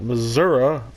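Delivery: Missouri ending in "uh" instead of "ee"; mocked wrong pronunciation. Shown as a demonstration. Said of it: "Only idiots say Missouri".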